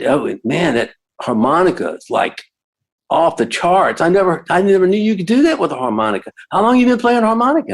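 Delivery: put-on excited teen. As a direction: blurt out the amazement fast. Teen fan praising harmonica.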